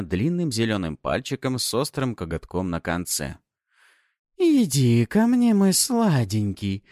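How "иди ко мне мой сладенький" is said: slow, high, teasing purr; playful hiss. Acted. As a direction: lure him in playfully. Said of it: "игриво прошипела суккуба".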